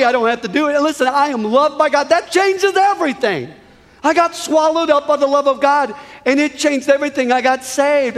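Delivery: high-pitched, fervent testimony; impassioned pulpit crescendo; exultant preaching. Real, not acted. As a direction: proclaim it joyfully to the whole room.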